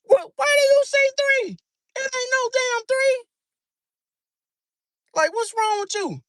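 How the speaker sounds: high-pitched exasperated yell. Retold or imitated. Pisces parent yelling at the kid saying three, exasperated. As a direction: shriek at the kid in disbelief. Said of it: "yelling like".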